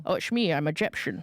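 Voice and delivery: quick comic Scottish-actor impression; slushy Scottish accent playing an Egyptian; Sean Connery. Radio host mocking an actor's unchanged Scottish accent, jokey impression. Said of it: "Sean Connery does a good Scottish accent for other accents".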